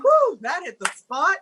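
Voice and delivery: high, put-on refreshed whoop; hammed-up fake relish; feigned satisfaction. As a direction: pretend you just downed a drink. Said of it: "I pretend like I downed it".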